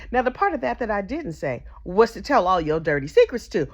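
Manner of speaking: ordinary delivery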